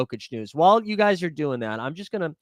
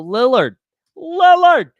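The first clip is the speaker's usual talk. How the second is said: building from firm to a loud high yell; excited shout; 8 semitones higher, 10 dB louder, slower than usual. Real, not acted. Streamer hyped about Lillard, building excited shout. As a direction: shout the name louder the second time.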